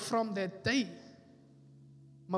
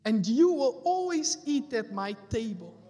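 loud scripture declaration; 6 dB louder than usual. Acted. Preacher declaring scripture loudly.

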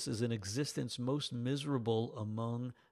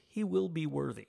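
ordinary talk

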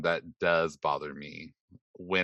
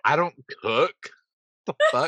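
higher, louder, incredulous; mock indignation; 7 semitones higher, 5 dB louder than usual. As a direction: push it up in disbelief. Imagined indignant reply.

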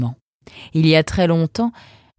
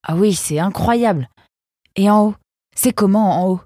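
higher, eager questioning; marvel, then ask keenly what's up top; wide-eyed enthusiasm; excited wonder; 6 semitones higher, 4 dB louder than usual. A narrator's reading. Nemrod marvels at the rock.

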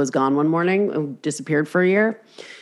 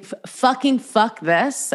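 exasperated; 5 semitones higher, slower than usual. Retold or imitated. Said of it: "every time you think".

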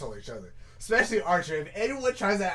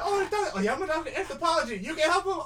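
mock eager high voice; 12 semitones higher, 6 dB louder, faster than usual. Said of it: "I was like".

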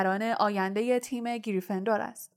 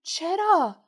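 shocked why; 8 semitones higher, 3 dB louder, much slower than usual. Acted. All players ask in dismay.

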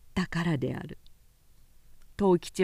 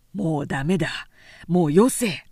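lower, louder man's voice cutting in; firm stop; 4 semitones lower, 6 dB louder than usual. A narrator's reading. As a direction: stop her drinking, firmly. Man stops drunk woman.

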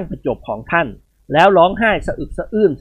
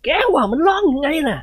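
mock surprise; 12 semitones higher, 4 dB louder than usual. Chao Khun teasing son-in-law.

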